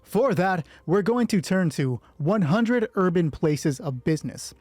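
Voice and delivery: ordinary talk, nothing marked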